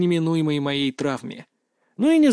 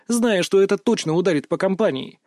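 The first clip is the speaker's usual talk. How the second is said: ordinary delivery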